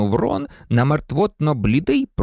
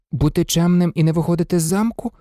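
ordinary delivery

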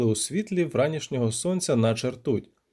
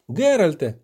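louder call by name; sudden alerting call; 3 semitones higher, 5 dB louder than usual. A narrator's reading. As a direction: call for your companion's attention. Poet calls out to companion.

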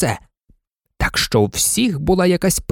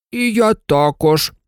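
slow, higher-pitched bear grumble; grumbling teddy bear; 7 semitones higher, slower than usual. A narrator's reading. Yellow teddy bear agreeing with the dog, slow grumble. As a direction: grumble it slowly as a toy bear. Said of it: "пробурчав жовтий ведмедик".

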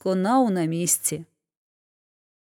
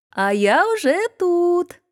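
drawn out, much higher, slow call across the field; 9 semitones higher, 3 dB louder, slower than usual. A narrator's reading. Hedgehog wife calls to the arriving hare, high and drawn out. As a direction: sing out triumphantly with stress on я. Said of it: "кричить йому назустріч".

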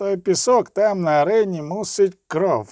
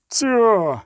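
higher, drawn cry; narrated shout, moderately raised; shouted exclamation; 4 semitones higher, 3 dB louder than usual. A narrator's reading. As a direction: call it out sharply to the group. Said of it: "крикнув кінська шкіра".

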